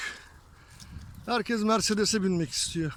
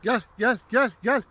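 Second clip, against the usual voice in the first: calling out; 3 semitones higher, 4 dB louder than usual. Real, not acted. Calling the sheep.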